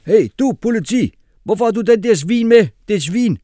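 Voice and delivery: high, loud street slang voice; Kasim shouting. A narrator's reading. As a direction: yell the question like a hyped kid. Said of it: "spurgte Kasim".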